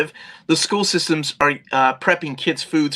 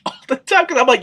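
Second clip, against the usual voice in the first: usual speaking voice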